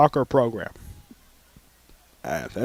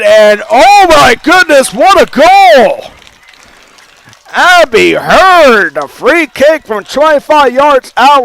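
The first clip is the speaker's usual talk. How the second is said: high, loud, stretched goal call; long goal yell; 10 semitones higher, 12 dB louder than usual. Real, not acted. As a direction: roar the goal call, drawing out the words. Commentator calls a free-kick goal.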